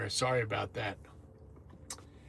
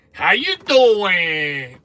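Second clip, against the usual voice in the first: high, loud, very slow "How you doing?"; stretched greeting; 5 semitones higher, 10 dB louder, much slower than usual. Real, not acted. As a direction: draw the greeting out big. Drawn-out greeting to viewers.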